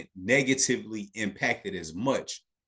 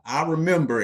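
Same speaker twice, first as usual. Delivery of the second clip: high, louder sing-out of a chat reply; higher pitched voice; 6 semitones higher, 4 dB louder than usual. Shown as a demonstration. Host prompting viewers to type in the chat, higher pitched voice. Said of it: "I did it in, like, a higher pitched voice".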